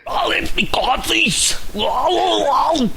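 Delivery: high, slow mock Arnold Schwarzenegger accent; a hammed-up Austrian Arnie accent, stretched out. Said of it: "my Arnie accent".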